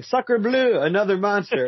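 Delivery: high-pitched alarmed cry; hammed-up Frenchman crying out in alarm; mock French yell. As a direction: yell it like a panicked bystander. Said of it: "the French guy there yelling".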